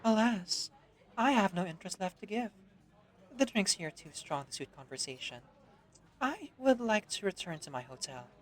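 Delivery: soft sleepy voice. Acted. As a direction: sigh it out, tired of the party. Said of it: "Her voice is soft and sleepy".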